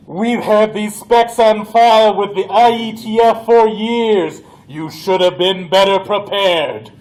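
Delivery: loud, scolding alien voice; alien scolding yell. Acted. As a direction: chew them out for being unprepared. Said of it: "it starts yelling".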